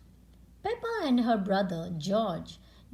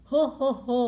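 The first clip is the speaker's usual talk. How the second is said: loud cheerful ho ho ho; jolly laugh; 3 semitones higher, 7 dB louder, much faster than usual. Acted. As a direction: laugh kindly at the worry. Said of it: "Mommy Pig said".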